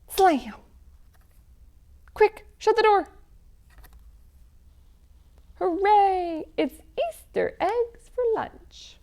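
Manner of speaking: bouncy, sing-song picture book chant; lively read-aloud for children. Acted. Reading an Easter egg hunt story to children, sing-song. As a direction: read it like a game for little ones.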